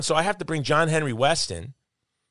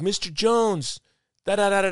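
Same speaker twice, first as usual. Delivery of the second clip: put-on higher, louder eager kid; eager teen voice; 9 semitones higher, 3 dB louder than usual. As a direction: pester like an excited teenager. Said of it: "kid is like".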